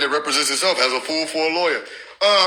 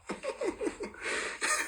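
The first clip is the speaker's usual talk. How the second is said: airy laugh; 15 dB quieter than usual. Real, not acted. Laughing hard.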